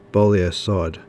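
usual speaking voice

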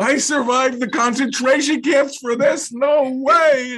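put-on old Catskills audience member booing a comic, loud cranky voice. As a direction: squawk it like an angry old man in the crowd. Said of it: "people were just screaming at him".

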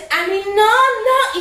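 mock protest. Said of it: "y yo pegando gritos".

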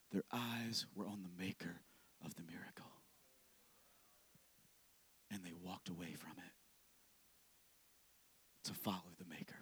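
very soft, low, voiceless whisper; quiet, awed sermon whisper; hushed whisper. Acted. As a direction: breathe it out reverently. Whispered close of point.

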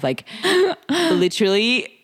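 ordinary talk, nothing marked

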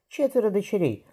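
high surprised exclamation; gasping in surprise. A narrator's reading. Merchant's wife amazed at four daughters, high gasp. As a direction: let it burst out, amazed. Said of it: "ахнула Эмма".

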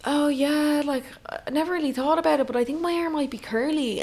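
high airy ditzy girl impression; mocking girly voice. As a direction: lilt it up like a clueless girl. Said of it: "when girls are like".

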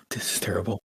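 breathy panicked whisper. Acted. Panicked whispering in prison yard.

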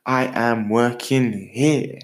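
slow, measured, gently paced affirmations; slow affirmation to repeat. Shown as a demonstration. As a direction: lead the listener through each line slowly. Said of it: "Repeat after me".

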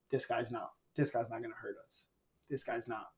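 low, very soft thinking aloud; quiet retold inner voice; hushed realisation. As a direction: say it under your breath, as a thought. Retold inner realisation about intruder.

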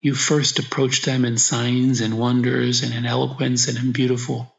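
ordinary delivery

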